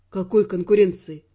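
low, puzzled man's question; uncomprehending. A narrator's reading. Raymond asking the heroine what rivalry she means, low and puzzled. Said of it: "непонимающе нахмурился он".

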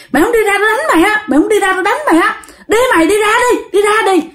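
high, hurried threats; old man driving someone off. Acted. Old man threatens the ghost with his cane, high and scolding. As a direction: order him out, fast and insistent. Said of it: "Là ông già đuổi cái thằng đó".

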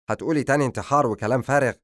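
put-on testy young man; mild irritation. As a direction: cut her off, a touch annoyed. Patient irritated at question.

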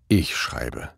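plain reading voice

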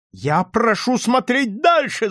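high, slow, forceful insistence; shouted demand. A narrator's reading. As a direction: cry it out, pressing every word. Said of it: "закричал офицер".

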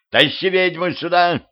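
high-pitched command called upward; shouted order. A narrator's reading. Woman orders her helper to bring the witch, calling. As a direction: call it out to someone at a distance.

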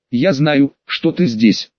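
ordinary delivery, nothing marked

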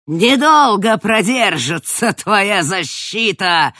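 slow, loud defiant shout; shouted threat. Acted. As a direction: hurl it back as a threat. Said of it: "выкрикнула Лазария".

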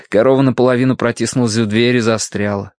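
ordinary delivery, nothing marked

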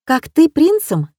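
surprised. A narrator's reading. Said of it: "удивлённо".

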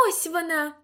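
high-pitched call of discovery; excited cry. A narrator's reading. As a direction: cry out that you found it. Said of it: "відчинила дверцята і крикнула".

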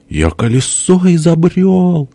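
very high, drawn-out character cry; plaintive boast. A narrator's reading. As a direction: stretch it out high, insisting. Перепалка: похвальба и возражение. (высокий протяжный выкрик)

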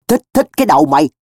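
high, fast angry retort; irate, mocking comeback; fuming. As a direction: snap back, fuming at a friend. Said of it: "Tôi hầm hầm".

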